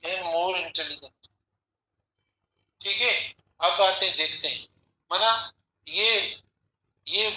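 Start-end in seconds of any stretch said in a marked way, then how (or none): 0.0-1.3 dictating each option word by word, slowly; teacher dictating answer options in a measured pace; slow dictation. Acted. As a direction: read it out so students can write along.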